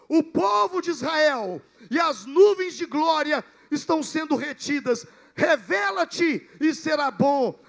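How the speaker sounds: loud, commanding cry to summon Joseph; grito de Moisés. Acted. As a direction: shout it out as a solemn, drawn-out proclamation. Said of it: "E Moisés grita assim". (brado solene de Moisés, em voz muito alta)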